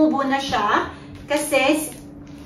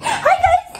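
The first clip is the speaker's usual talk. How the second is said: put-on baby-talk greeting. Shown as a demonstration. Coaching baby.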